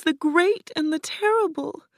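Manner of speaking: put-on very high, plaintive little girl voice; tearful Dorothy. A narrator's reading. Crying girl names Oz's title, tearful. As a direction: squeak the title out high, near tears. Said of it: "Dorothy began to cry".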